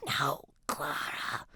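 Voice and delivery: slow, insistent whisper. A narrator's reading. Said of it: "Jalen whispers".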